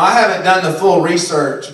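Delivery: usual speaking voice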